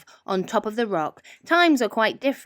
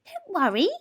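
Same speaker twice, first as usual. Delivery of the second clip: 1 peeping mouse; 10 semitones higher than usual. A narrator's reading. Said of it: "Mouse peeped".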